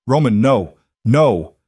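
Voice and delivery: shouting after him; desperate shout. A narrator's reading. Door closing on her.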